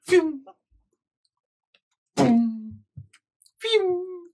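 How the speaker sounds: high-pitched whooshes and boom; playful high sound effects, spaced apart; zooming noises. Acted. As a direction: imitate a speeding boat zipping past. Imitating boat zooming round corners.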